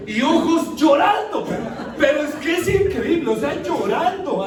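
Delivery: loud, high, mock-incredulous outburst; comic exasperation. Preacher telling the church about his wife's crying emojis, comic exasperation. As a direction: cry it out in hammed-up disbelief.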